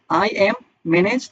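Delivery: I am manage dragged out very slowly; wrong form, drawn out. Shown as a demonstration. Teacher slowly shows the wrong form. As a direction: stretch "I am manage" out word by word as the wrong version. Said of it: "নাকি managing".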